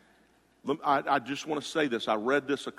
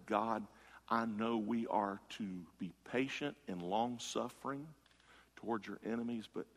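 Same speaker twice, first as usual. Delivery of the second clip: much softer, lower, sinking to near hush; hushed confessing prayer; 4 semitones lower, 11 dB quieter than usual. Retold or imitated. Preacher voicing a believer's secret prayer, hushed and lowered. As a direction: confess it under your breath to God.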